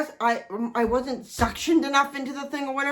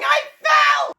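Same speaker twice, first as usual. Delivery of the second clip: high, loud whiny cry of I fell; hammed-up shriek of self-pity; mock wailing; 7 dB louder than usual. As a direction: wail it like a helpless child.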